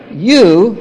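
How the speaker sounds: loud, punched YOU standing alone; very emphatic YOU. Acted. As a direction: hit YOU hard, then leave it hanging. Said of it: "very emphatic".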